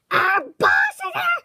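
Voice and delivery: loud, high panicked cry; alarmed shout. Acted. Warning friends the boss pig is here, loud and panicked. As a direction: yell the alarm.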